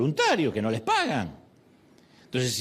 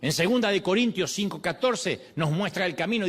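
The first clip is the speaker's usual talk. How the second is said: ordinary talk, nothing marked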